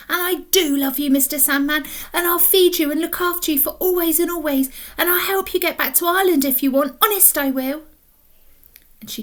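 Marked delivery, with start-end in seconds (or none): 0.1-7.8 put-on a hint higher, earnest little girl; girl promising to care for him; tender promise. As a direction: promise it with all her heart.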